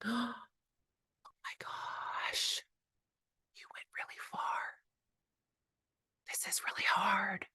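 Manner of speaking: hushed whisper of concern; countering a wild kid with a whisper. Shown as a demonstration. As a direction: drop to a whisper to calm them. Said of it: "I go quiet".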